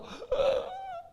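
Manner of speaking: a big high gasp of outrage; mock shocked gasp. Preacher acting David's shock, a high gasp. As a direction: gasp as if scandalised.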